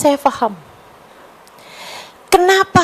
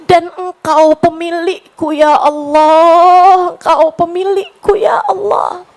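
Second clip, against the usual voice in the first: higher, slow, fervent prayer voice; pleading prayer; 5 semitones higher, slower than usual. Acted. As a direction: beg God earnestly and slowly. Leads an emotional prayer. (doa memohon dengan khusyuk, pelan)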